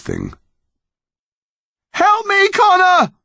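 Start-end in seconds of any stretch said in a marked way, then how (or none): 1.9-3.1 high-pitched desperate yell. A narrator's reading. Mum to Connor from the cliff edge, desperate yell. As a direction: cry out for help at the top of the voice. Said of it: "his mum yelled".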